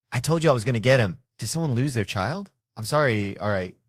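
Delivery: ordinary talk, nothing marked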